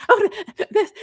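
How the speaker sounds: high, loud, laughing stammer; giggly stunned sister voice; imitating sister. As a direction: play the amazed sister, tongue-tied. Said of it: "she's like".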